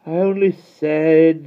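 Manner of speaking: high, slow and teasing; provoking little brother; annoying drawl. A narrator's reading. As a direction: drag it out to needle her. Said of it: "he began annoyingly".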